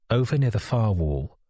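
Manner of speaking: ordinary delivery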